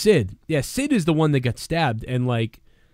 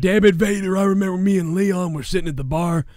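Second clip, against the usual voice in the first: Stone Cold; 5 semitones higher than usual. Acted. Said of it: "Stone Cold".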